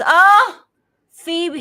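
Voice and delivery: loud, high-pitched mock dismay; exaggerated high lament, imitated; goofy son. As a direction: imitate a goofy son's big "oh". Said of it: "He's a little goofy".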